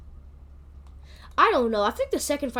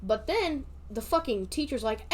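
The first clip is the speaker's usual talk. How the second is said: ordinary talk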